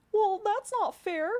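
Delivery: high, pouty little fungus voice; twinkling breathy fairy whisper. Acted. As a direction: whine it like a tiny sprout. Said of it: "you hear another little".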